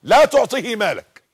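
loud, high, slow, stress on لا; preached command. Shown as a demonstration. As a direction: hammer out لا تعطيه مالك. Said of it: "اسمع كلام النبي بقى". (أمر النبي بصوت عال وبطء)

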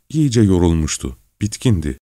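ordinary delivery

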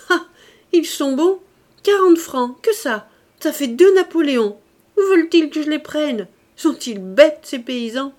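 loud, high-pitched mocking merriment; hilarité moqueuse. A narrator's reading. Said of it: "Elle se mit à rire aux éclats". (hilare et moqueuse, voix haute et forte)

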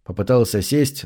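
ordinary delivery

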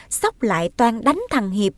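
ordinary delivery, nothing marked

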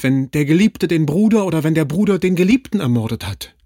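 tearful, anguished outcry; loud sobbing cry. A narrator's reading. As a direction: sob out a frantic plea to stop them. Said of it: "Schluchzend rief sie laut".

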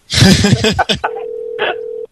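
silent wheezing laugh; breathy laughter. Real, not acted. Laughing after prank call.

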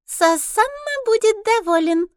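very high squeaky harpy, slow and loud; shrill gloating creature voice. A narrator's reading. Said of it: "песклявым голосом".

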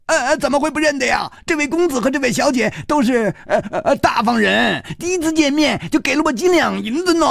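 put-on a cheeky beggar kid grinning wide; beaming urchin. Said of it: "立刻眉开眼笑". (小秃子眉开眼笑地抢白，尖声快嘴)

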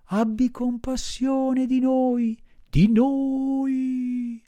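high, slow, pitiful whine; whining voice. Acted. Fox begging Pinocchio for charity, high whining. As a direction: wheedle like a beggar fox. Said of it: "con voce di piagnisteo".

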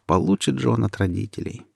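ordinary delivery

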